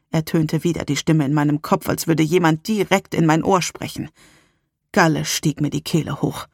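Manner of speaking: ordinary delivery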